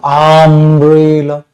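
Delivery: umbrella stretched very slowly; slow word demo. Shown as a demonstration. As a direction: draw umbrella out syllable by syllable. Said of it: "ब्रेक तो नहीं मारोगे".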